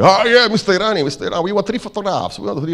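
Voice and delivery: high-pitched, hammed-up Norwegian accent; bad Norwegian accent. Norwegian manager greets the photographer, bad Norwegian accent. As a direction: put on a poor Norwegian accent. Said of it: "I'm doing a very bad accent".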